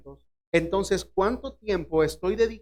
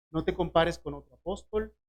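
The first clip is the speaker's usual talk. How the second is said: ordinary delivery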